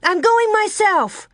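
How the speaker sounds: high, loud accusing cry; frantic little sister. A narrator's reading. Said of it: "Carrie cried".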